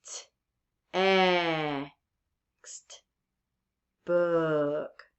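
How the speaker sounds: "T, e, xt, book" broken into drawn-out sounds with pauses; sounded out slowly. Shown as a demonstration. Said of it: "how many vowel sounds do you hear".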